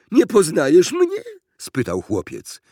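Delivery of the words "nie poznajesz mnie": put-on high, loud boyish question; eager call. A narrator's reading. Boy greets old schoolmate through window, calling eagerly.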